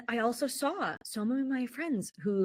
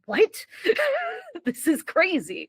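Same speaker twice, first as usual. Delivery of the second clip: laughing disbelief; 11 semitones higher, 3 dB louder, slower than usual. Retold or imitated. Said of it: "And I was like".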